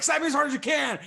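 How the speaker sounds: high, loud mocking imitation of the girlfriend. Friend mocks the girlfriend's request, in a high, loud imitation. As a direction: shout it up high like a taunting drunk girl.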